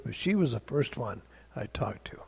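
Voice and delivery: ordinary talk, nothing marked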